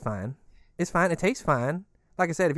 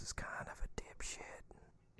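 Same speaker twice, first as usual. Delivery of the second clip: hushed conspiratorial whisper; 20 dB quieter, slower than usual. Real, not acted. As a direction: whisper it so nobody overhears. Gossiping so others won't hear.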